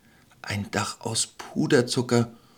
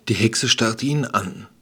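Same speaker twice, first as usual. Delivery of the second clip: usual speaking voice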